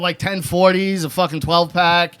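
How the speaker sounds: ordinary talk, nothing marked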